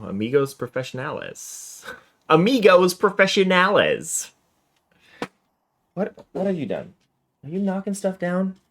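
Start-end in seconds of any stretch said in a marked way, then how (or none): 2.3-4.3 higher and louder, playfully overdone; hammed-up Spanish. Player relishing the note's sign-off, hammed-up and higher. 5.9-8.6 high, light voice, questioning; high-pitched scolding. Real, not acted. Player to a culprit knocking stuff down, high scolding.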